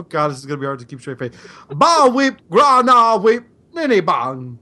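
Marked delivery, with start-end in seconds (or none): 1.7-4.6 high, loud nonsense greeting in Walken's voice; Christopher Walken. Acted. Reciting the alien greeting to a fan as Walken, hammed up. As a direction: chant the gibberish greeting like a strange alien hello. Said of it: "as Christopher Walken".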